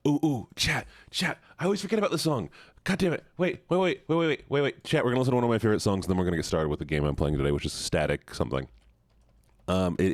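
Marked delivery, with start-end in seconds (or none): 0.1-4.8 excited high panic. Real, not acted. Suddenly remembers the song. 4.8-8.7 rushed. Real, not acted. Rushed announcement.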